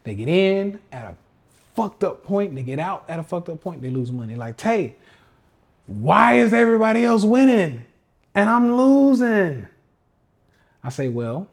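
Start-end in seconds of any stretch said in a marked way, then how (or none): 4.6-9.7 high, loud and drawn-out complaint; mock desperate wail, high and slow; whiny losing trader. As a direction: plead with Tay like a beginner who keeps losing. Losing newbie whines to Tay.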